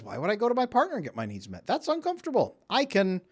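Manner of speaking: high, whiny self-justifying voice; inner voice rationalising. Retold or imitated. The brain talking itself out of going to the partner, high and whiny. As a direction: voice the lazy brain making excuses.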